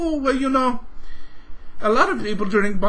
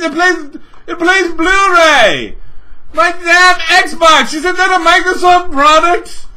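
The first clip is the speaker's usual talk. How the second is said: yelling higher and louder, outraged; furious shout; 6 semitones higher, 8 dB louder than usual. Real, not acted. Ranting at Microsoft about DVDs, furious shout. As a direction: blow up at them, full-voiced outrage.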